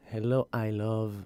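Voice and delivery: normal talking voice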